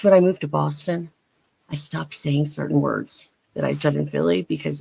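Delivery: ordinary talk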